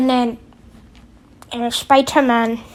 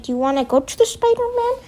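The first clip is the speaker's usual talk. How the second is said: much higher, fast squeaky character voice; squeaky villain voice; 12 semitones higher, faster than usual. Retold or imitated. Goblin squeaks at Doc Ock, a fast high silly voice. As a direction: pipe it up high and rush it like a cartoon villain. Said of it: "And then he's like".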